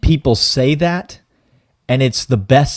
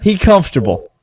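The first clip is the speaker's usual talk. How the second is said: smug and raised; 6 semitones higher, 6 dB louder than usual. Acted. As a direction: gloat it, high and loud. Mock royal boast.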